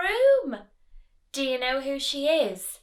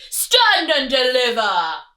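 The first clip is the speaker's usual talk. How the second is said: thundering rat; 7 dB louder than usual. Acted. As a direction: thunder the demand. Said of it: "who thundered".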